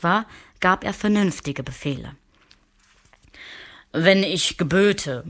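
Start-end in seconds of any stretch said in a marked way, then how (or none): 3.9-5.2 slow, stately royal musing; lightly voiced king, slow and stately; grand king. A narrator's reading. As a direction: ponder like an absolute monarch. The king muses.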